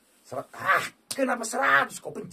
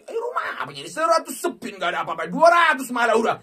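much higher, loud anguished yelling; wailing angry shout; 10 semitones higher, 10 dB louder than usual. Real, not acted. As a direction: rage and wail at the game. Angry at slot losing. (teriakan marah meraung)

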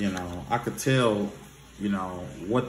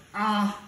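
teacher's throat clear; 12 semitones higher than usual. Retold or imitated. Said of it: "he was like".

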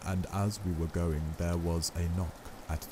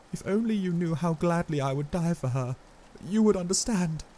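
choked plea; 12 semitones higher, 5 dB louder, faster than usual. A narrator's reading. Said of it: "a sort of choke in his voice".

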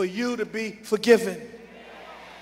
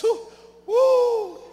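high joyful whoop; excited whoop from the pulpit; 11 semitones higher than usual. Real, not acted. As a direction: cry out in joy. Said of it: "I'm excited just thinking about that".

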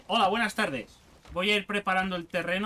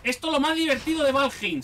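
louder, mock-cheerful sarcastic praise; sarcastic fake excitement about mining iron; ironic enthusiasm; 5 semitones higher, 5 dB louder than usual. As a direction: sell the boring chore as the best fun, tongue in cheek. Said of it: "No se ha notado mi ironía".

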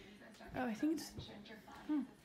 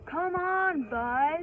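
raised, high urging call; impatient calling out; 5 semitones higher, 6 dB louder, much slower than usual. Real, not acted. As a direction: call out to hurry the bus along. Urging a late bus along.

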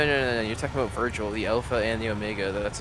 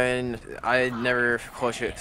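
usual speaking voice

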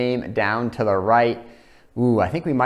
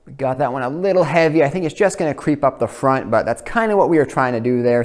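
ordinary delivery

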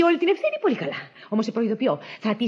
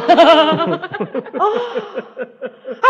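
loud laughing fit; 2 semitones higher, 6 dB louder than usual. Acted. Maid laughs at the angry mistress, loud fit. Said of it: "εμένα με πιάνουν τα γέλια".

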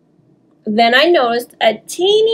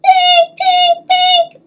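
high, beeping alarm clock sounds, much higher; imitated alarm beeps; 4 dB louder, much slower than usual. Alarm clock ringing to wake the girl, high beeping. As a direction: chirp it out like a tiny alarm clock. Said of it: "went my alarm".

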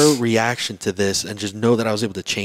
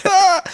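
very high, loud comic yelp; mock panicked scream; 10 dB louder than usual. Panicked reply to parents' pressure.

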